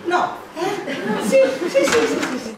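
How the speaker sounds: excited. Real, not acted. Emphatic yes, yes, yes.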